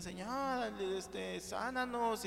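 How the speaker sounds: slow, drawn-out mock plea for healing; pleading lepers. Preacher voicing the ten lepers begging the Lord, slow mock plea. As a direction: stretch out sánanos like a lightly voiced beggar. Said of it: "y le gritaban".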